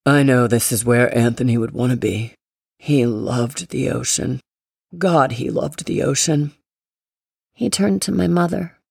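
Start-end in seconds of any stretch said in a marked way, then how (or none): 0.0-6.5 deep, heavy grandfather voice; grandpa voice. A narrator's reading. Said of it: "Grandpa Ricci's voice".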